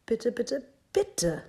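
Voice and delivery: put-on high, insistent child's plea; begging. A narrator's reading. Little dinosaur Minus begs Mama for a pet, begging. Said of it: "bettelte Minus".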